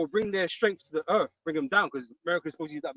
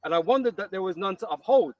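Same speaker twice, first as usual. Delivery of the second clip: firm, louder reading of the Bible verse; loud, solemn scripture reading; 4 dB louder than usual. Acted. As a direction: read it out like holy writ. Reads scripture aloud.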